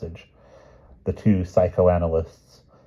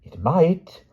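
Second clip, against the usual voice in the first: high, reasoning queen voice; prim queen stating the obvious; the Queen, logically; 11 semitones higher, 6 dB louder than usual. Acted. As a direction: lay it out as plain logic, raised pitch. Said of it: "said the Queen logically".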